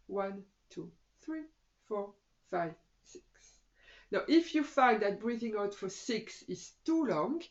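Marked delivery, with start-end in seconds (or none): 0.1-3.2 evenly spaced, quiet counting for an out-breath; measured breath count. Shown as a demonstration. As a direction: softly count out each number with pauses.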